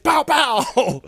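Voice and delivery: loud, high shouted pows; explosive vocal sound effect; window-banging imitation. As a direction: smack the window with your voice.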